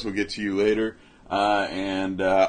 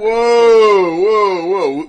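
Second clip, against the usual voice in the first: high, loud whoas stepping downward; loud mock objection; 9 semitones higher, 10 dB louder, much slower than usual. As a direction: cut them off with hammed-up protest. Objects to slander of favourite actor.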